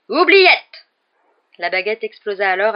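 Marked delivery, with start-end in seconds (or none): 0.1-0.9 a very high, very loud cry; yelled spell. A narrator's reading. Lockhart casts the memory charm, screaming. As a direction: hurl the spell with all your force.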